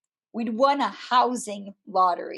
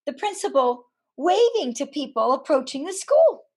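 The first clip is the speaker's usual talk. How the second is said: normal talking voice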